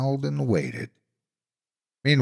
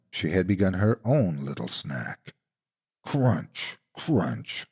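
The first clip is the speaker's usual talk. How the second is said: plain reading voice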